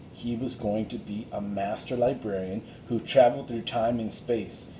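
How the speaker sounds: ordinary delivery, nothing marked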